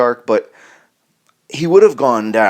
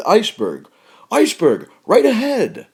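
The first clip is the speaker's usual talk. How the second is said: scared warning; 10 semitones higher than usual. Shown as a demonstration. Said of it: "You're scared".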